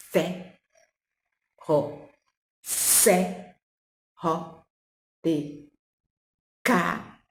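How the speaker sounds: each syllable said apart with clear pauses. Shown as a demonstration. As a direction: sound out the syllables one at a time. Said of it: "vai formar uma sílaba". (sílaba por sílaba, com pausas)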